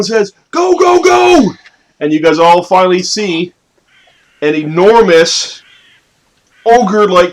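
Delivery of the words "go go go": high, shrill-free goblin yell, much higher. Acted. Game master voicing the goblin urging the ogre on, high yell. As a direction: squeak out the goblin's urgent shout. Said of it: "The goblin yells out".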